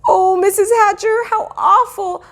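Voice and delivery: high, loud, weepy apology; crying girl. A narrator's reading. Sheila cries her apology to Mrs Hatcher, high and weepy. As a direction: sob out the sorry. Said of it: "Sheila cried".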